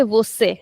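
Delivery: você with the final ê opened wide and drawn out; wrong open ending. Shown as a demonstration. Teacher showing students the mistake to avoid, open drawn-out você. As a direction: say você with a long, open last vowel. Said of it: "So we don't say".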